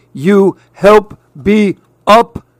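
high, slow strained voice; upside-down lizard voice. Acted. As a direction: talk while hanging upside down. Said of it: "said Napoleon".

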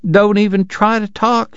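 doting wife greets the ragged traveler, high and fussing; fussing Southern lady. Acted. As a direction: coo over the sick boy in a high voice. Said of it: "she cried".

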